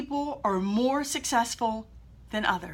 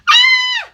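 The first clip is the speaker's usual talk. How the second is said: high loud shriek of fear; panic scream; 14 dB louder than usual. Retold or imitated. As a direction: scream as the ground comes up. Looking down mid-jump, panic.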